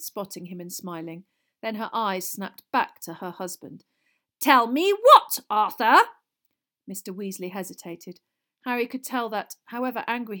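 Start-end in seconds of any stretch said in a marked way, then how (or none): 4.4-6.0 high, loud suspicious question; sharp demand. A narrator's reading. Wife turns on her husband, sharp demand.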